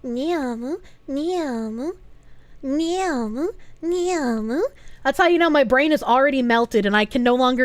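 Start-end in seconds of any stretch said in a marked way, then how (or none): 0.0-4.6 soft, rising drawn-out call; soft repeated mewling. Acted. Streamer whose brain has melted asking for help, soft mewling. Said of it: "that's when I just go".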